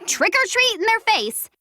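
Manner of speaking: high-pitched excited mock yell; excited, high imitation of screaming. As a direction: pitch it way up as if yelling.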